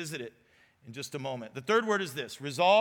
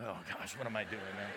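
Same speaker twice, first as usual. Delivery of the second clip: much lower, much softer, half-broken; despairing to himself; 10 semitones lower, 11 dB quieter than usual. Retold or imitated. Husband after hanging up, near tears, low and soft. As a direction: sink into quiet despair alone. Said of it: "I'd start crying".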